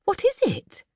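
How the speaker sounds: a nervous wife checking on trouble; anxiously. Acted. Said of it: "said Missus Banks anxiously".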